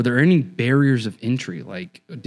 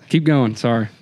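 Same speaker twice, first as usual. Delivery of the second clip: ordinary delivery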